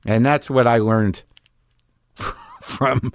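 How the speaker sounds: normal talking voice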